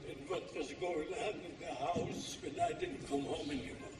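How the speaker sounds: usual speaking voice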